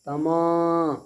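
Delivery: तमा with the मा stretched and firm; madd held long. Shown as a demonstration. Teacher demonstrating a one-alif stretch, long held vowel. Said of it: "खींचेंगे एक अलिफ के बराबर".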